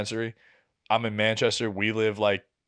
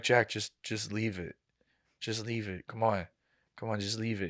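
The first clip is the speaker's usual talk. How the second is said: put-on hushed plea to a drunk partner; softly begging him to let it go; quiet pleading; 3 semitones lower, 9 dB quieter than usual. Girlfriend pleading with drunk cousin.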